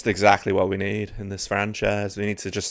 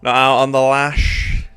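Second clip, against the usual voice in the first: louder drawn-out teasing, pitched up; teasing mock disbelief; 4 semitones higher, 7 dB louder than usual. Real, not acted. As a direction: rib your mate about not going out. Teasing friend Sloth.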